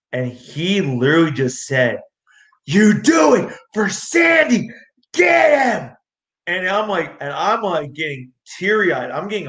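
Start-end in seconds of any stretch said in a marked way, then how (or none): 2.6-5.9 high, drawn-out yell to a fighter; retold ringside shout. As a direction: shout it out slowly to fire the fighter up. Retold ringside urging before a fight.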